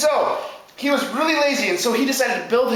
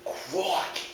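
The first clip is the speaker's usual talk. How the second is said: tiny, hushed Aussie exclamation; small, deflated piggy voice; stunned first pig; 5 semitones lower, 11 dB quieter than usual. Acted. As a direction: let it out very softly, dumbfounded. Pig left exposed.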